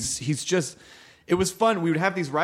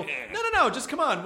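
coaxing; 8 semitones higher than usual. Retold or imitated. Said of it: "And I go".

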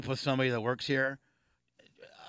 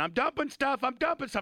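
retold yelling; 12 semitones higher than usual. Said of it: "started banging on the dumpster saying".

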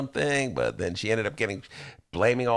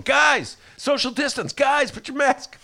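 high, pleading call to the group; nagging friend; 14 semitones higher, 4 dB louder than usual. Retold or imitated. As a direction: imitate a party scold, pitched way up. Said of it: "I was the one guy going".

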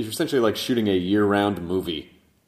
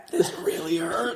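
high, slow, hammed-up suffering; strained, drawn-out mock complaint; mock pained actor; 9 semitones higher than usual. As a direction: act out an actor regretting a painful voice. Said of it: "you're gonna be like".

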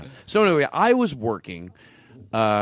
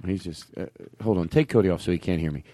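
ordinary delivery

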